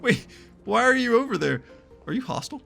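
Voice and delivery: usual speaking voice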